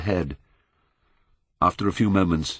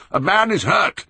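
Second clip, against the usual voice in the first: much higher, loud call to the line; shouting at the top of his voice; 12 semitones higher, 5 dB louder than usual. A narrator's reading. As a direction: yell to stop the guns far off. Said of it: "he called out at the top of his voice".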